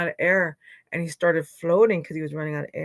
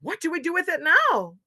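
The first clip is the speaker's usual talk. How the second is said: excited; 10 semitones higher, 8 dB louder than usual. Acted. Animals cheering the return.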